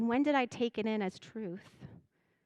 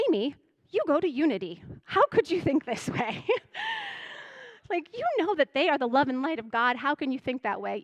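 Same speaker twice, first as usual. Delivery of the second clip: louder, higher mock scolding of herself; self-scolding; 5 semitones higher, 5 dB louder than usual. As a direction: scold yourself like a stern inner critic.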